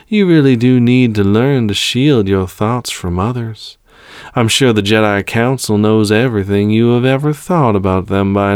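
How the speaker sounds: smooth, superior villain voice; calm villain. Acted. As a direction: toy with your prisoner, drawing out words. Villain lectures his prisoner.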